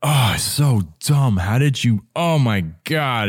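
high, exasperated self-scolding; aggressive inner critic. Retold or imitated. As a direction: berate yourself in mock disgust. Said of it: "he wanted to just be so aggressive".

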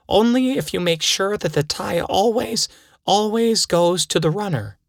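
high, insistent character voice; high-pitched bargaining. Acted. Bargaining with the pills.

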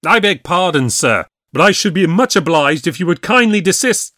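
high, loud, stiffly polite anger; a furious lawyer forcing courtesy; angry. A narrator's reading. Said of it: "and very angry he looked".

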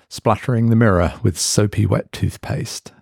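ordinary delivery, nothing marked

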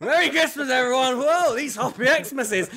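put-on high, loud drunken cheer; hammed-up boozy holler, drawn out at the end; tipsy shouting. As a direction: whoop it out like a merry drunk on air. Retold drunken quiz hosting.

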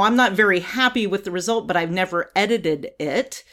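ordinary talk, nothing marked

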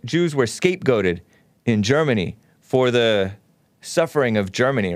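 ordinary delivery

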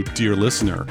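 ordinary talk

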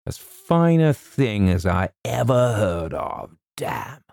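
lightly voiced Colonel, drawn out; admiring Colonel. A narrator's reading. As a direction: savour the praise like an old officer. The Colonel praises a brave deed.

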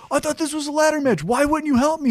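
high, fast, puzzled R-Truth voice; lightly voiced R-Truth, fast and high; imitating R-Truth. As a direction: whine it like a confused friend. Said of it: "R-Truth is like".

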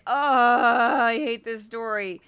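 loud, long wailing groan; mock-cry out in exasperation; hammed-up despair. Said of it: "absolutely cannot stand the Hunter Biden story".

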